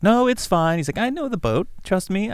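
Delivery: high, breezy know-it-all; cocky character voice. Acted. Friend insisting to Darwin that the boat is fine, cocky high voice. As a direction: wave off the warning, sure of the boat. Confident character brushing off warning.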